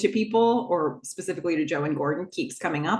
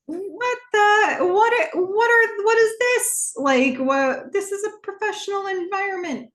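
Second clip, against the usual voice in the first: high-pitched, loud acted outrage; hammed-up indignant stammer; put-off, flustered protest; 13 semitones higher, 4 dB louder than usual. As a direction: sputter it out high, as if appalled by what you see. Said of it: "he's a little put off".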